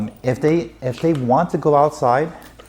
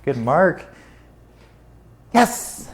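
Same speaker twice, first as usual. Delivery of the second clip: high, sharp Yes! said higher; marker praise; 4 semitones higher, much slower than usual. Real, not acted. Trainer rewarding the dog, sharp and high.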